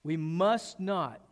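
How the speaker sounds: MUST NOT stretched slow and firm; moral emphasis. Retold or imitated. David starts to preach to his men, emphatic. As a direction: lean hard and slowly on "must not". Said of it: "that's the moral emphasis".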